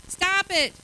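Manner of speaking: sharp, loud command to stop; firm stop. Real, not acted. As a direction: cut them off loudly and firmly. Preacher scolding congregation's self-talk.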